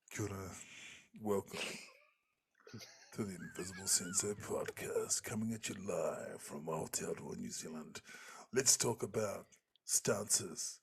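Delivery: slow, low host voice close to the mic; Ken Knight. Acted. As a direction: draw out the podcast opening, leaving long pauses. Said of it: "he gets real close to the mic".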